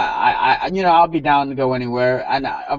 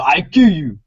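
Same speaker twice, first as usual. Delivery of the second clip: put-on African accent; 5 dB louder than usual. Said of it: "in his African accent".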